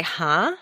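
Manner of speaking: HUH rising like a question; "huh?" climbing to the top; questioning rise. Shown as a demonstration. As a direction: swing "huh" upward as if asking. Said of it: "when we're indicating a question".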